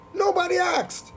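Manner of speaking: high-pitched exasperated wail. Real, not acted. Host capping a rant about the remix, exasperated. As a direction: sing out the complaint high in disbelief.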